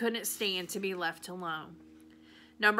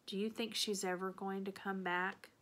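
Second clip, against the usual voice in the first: whispered; 8 dB quieter than usual. A narrator's reading. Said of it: "I whispered".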